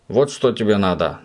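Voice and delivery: ordinary delivery, nothing marked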